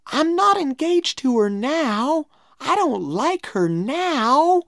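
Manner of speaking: put-on high, urgent boyish protest; pleading denial. A narrator's reading. Tom pleads, denying engagement.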